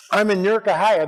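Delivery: Newark, Ohio run together as "Nerk-a-hia"; local pronunciation. Shown as a demonstration. Speaker shows how locals say Newark, Ohio, slurred together. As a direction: squash Newark into one syllable, Ohio into "a-hia". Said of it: "That's how you say it".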